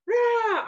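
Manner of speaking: high loud mock roar; happy roar. Mom cleaning the house, roaring with joy. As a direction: let out a bursting joyful rah. Joyful yell while cleaning.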